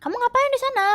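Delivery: high calling-out shout; high alarmed call to a friend on a roof; retold shout. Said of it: "Dia langsung teriak gitu kan".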